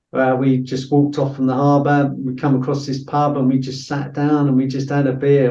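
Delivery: usual speaking voice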